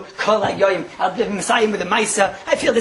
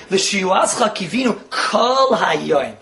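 slow recitation; much slower than usual. Shown as a demonstration. Reciting the prayer slowly.

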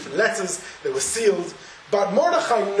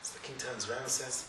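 ordinary talk, nothing marked

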